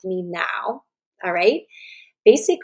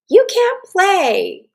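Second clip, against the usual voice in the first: put-on high, slow, drawn-out child's taunt; mock playground taunt; 12 semitones higher, 9 dB louder, much slower than usual. Playground kid telling a girl she can't play, high mock taunt. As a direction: play the kid shutting her out, stretched and high. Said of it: "a young lady here who sa-, hears".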